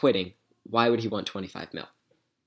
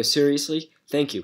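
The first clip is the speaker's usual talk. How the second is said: higher, louder mock astonished thanks; feigned surprise; 5 semitones higher, 5 dB louder than usual. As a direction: play along, as if amazed and grateful. Feigns thanks to trick the scammer.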